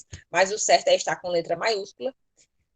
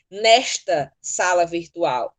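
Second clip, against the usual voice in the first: "Nesta" slow and set apart; stress on NESTA; 3 semitones lower, 4 dB louder, much slower than usual. Shown as a demonstration. Teacher repeating her example for the class, "Nesta" stressed. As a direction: lean on "Nesta", then pause.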